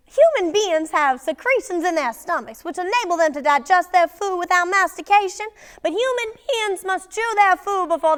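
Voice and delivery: put-on loud, high, overbearing mother in a theatrical accent; high-pitched, forceful stage matriarch with an accent; Amanda's Southern accent. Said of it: "she just had the best accent".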